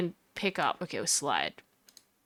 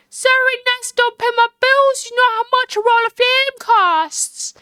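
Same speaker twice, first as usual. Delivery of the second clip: high, loud Southern drawl; 11 semitones higher, 4 dB louder than usual. Acted. Angry woman scolds over her wasted film, Southern drawl. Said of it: "she sounds like that".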